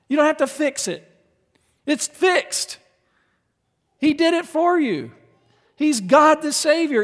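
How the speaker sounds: high, loud joyful preaching; fervent preaching. Acted. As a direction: proclaim it with excitement. Preaching climax.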